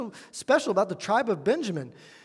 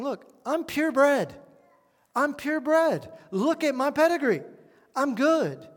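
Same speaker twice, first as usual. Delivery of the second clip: mock-proud boast, pitched higher; boastful; 5 semitones higher than usual. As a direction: show off your pedigree with raised pitch. Preacher voices Paul boasting of pedigree.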